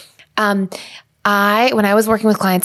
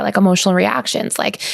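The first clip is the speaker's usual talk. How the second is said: ordinary talk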